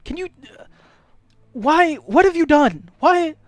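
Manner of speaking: high, loud, frantic questioning; panicking shopkeeper. Acted. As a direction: demand answers in a stammering panic. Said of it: "the people are panicking".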